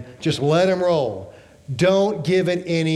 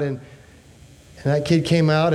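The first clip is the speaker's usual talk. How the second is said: normal talking voice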